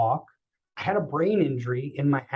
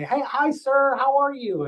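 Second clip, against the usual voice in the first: bright, much higher hello, full of himself; overly chipper greeting; 10 semitones higher than usual. Retold or imitated. Cocky young patient greeting the stranger, over-cheery and high. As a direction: breeze in all cheery and cocky.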